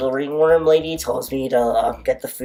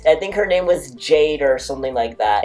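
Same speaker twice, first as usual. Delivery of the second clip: normal talking voice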